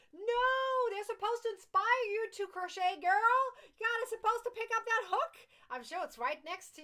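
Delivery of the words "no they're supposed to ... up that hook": high, loud mock telling-off; playful scolding. Playful scolding of viewer who hasn't crocheted.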